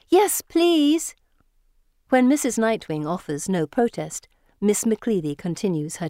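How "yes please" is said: high, loud drawn-out plea; eager chorus. A narrator's reading. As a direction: plead like a group of keen girls. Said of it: "join in a chorus of".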